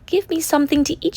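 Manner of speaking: put-on a shrunken girl piping up; tiny voice.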